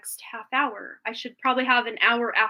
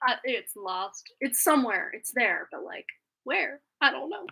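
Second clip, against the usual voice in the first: ordinary delivery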